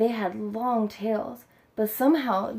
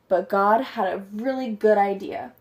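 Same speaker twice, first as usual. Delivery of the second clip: usual speaking voice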